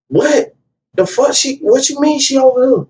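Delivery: high, disbelieving voice; shocked friend. Retold or imitated. Friend reacting on the phone, high disbelief. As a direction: sound thrown by the news. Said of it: "He like".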